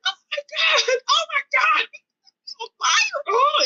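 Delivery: very high reenacted scream; screaming in panic. Driver thinking her face is on fire, screaming. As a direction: squeal it as if burning, without full volume. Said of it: "I'm screaming and hollering".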